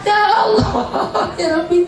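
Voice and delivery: high wailing plea; high mock wail; mock anguished cry. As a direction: wail to God like a burning sinner. Sinner crying out in hell.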